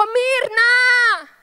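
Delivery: loud, high crying call of a name; father's sobbing shout of his daughter's name; anguished cry. Retold or imitated. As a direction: cry out her name in grief, breaking.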